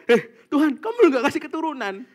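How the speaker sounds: loud, high mock challenge to God; hammed-up rude confrontation; rude complaint, acted. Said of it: "Kok kasar kayak gitu?".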